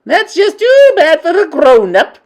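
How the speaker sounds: high, loud, dismissive snap; scornful witch queen. Acted. Grand High Witch dismissing a question, loud and scornful. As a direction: brush it off with contempt. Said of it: "said the Grand High Witch".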